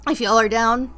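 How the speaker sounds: ordinary talk, nothing marked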